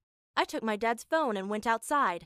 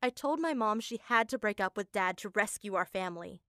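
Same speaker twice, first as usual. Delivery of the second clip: plain reading voice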